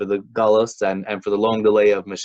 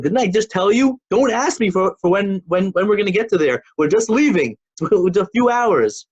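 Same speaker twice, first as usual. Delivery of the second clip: irritated father, voice pitched way up; angrier scolding father; 8 semitones higher than usual. Retold or imitated. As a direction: really lose patience with the kid. Father scolds son again.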